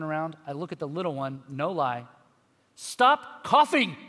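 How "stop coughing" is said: put-on loud, slow angry bark at a child; exasperated dad yelling at his coughing daughter; angry snap. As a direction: snap at her in frustration. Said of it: "in that tone of voice".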